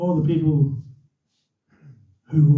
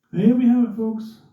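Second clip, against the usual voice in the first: higher, projected race announcer; radio commentator; 5 semitones higher than usual. Retold or imitated. Commentator to the waiting crowd, imitated announcer voice. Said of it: "He's the radio commentator".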